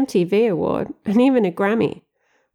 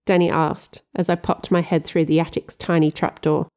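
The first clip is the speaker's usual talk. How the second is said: ordinary delivery, nothing marked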